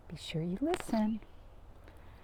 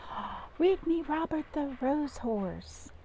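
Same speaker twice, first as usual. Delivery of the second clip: put-on little girl's request; 8 semitones higher than usual. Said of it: "she'd say".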